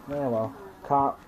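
ordinary talk

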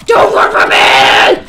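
loud, low, rough yell; menacing roar. Pretend. Player chasing a kid who runs, low menacing roar. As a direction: roar it slowly at someone fleeing.

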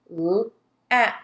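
"L-ap" as isolated l, a and p sounds; held l, then short a and a lone p in "L-ap"; sounded out. Shown as a demonstration. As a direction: tap out "L-ap" sound by sound.

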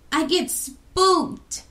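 slow, high nervous voice; drawn-out scared little voice; a child admitting she gets spooked. A narrator's reading. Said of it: "she said".

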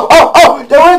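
loud high yells pointing the target out; excited shouts. Real, not acted. Gamer shouting to teammate to hit that one. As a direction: shout to mark the enemy. Spotting enemy.

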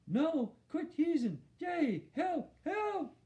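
very high muffled protest; mouth stuck. A narrator's reading. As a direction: protest with your lips stuck. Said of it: "like he had something in his mouth".

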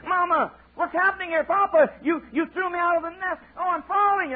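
very high frightened eaglet falling; baby bird screaming as it falls; panicked child. Acted. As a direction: cry out in alarm while tumbling. Eaglet pushed out of nest.

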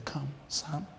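soft, tender invitation; gentle call. Acted. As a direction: coax him gently by the hand. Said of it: "take you by the hand and say".

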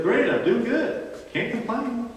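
a breezy, content Peter; hint of an easygoing Peter; voicing Peter. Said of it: "And Peter says".

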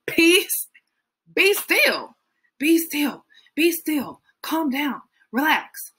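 high, emphatic "Peace, be still"; calming command. Acted. Jesus rebuking the storm, high and emphatic.

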